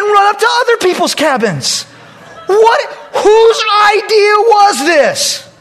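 high loud scolding, laughing through it; exasperated scolding. Retold or imitated. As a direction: rant in disbelief. Scolding the naked boys.

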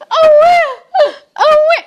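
mock child in distress; distressed child crying. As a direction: imitate a small child's frightened cry. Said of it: "you could hear this".

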